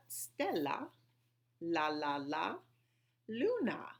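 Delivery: "Stella, la, la, la, Luna" split into syllables with the L repeated. Shown as a demonstration. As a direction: tap out each L: "Stella, la, la, la, Luna". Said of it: "Listen to all those L's".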